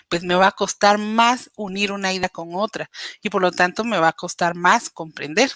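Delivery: ordinary delivery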